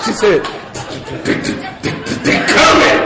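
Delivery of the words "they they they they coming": mock stammer, words barely getting out; tongue-tied stammer. Woman struggling to warn the teller, stammered. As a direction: imitate her stuttering it out. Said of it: "she couldn't say".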